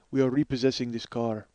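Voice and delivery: low Russian repo man; Russian accent. Acted. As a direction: do a low-voiced Russian guy. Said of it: "he's a Russian guy".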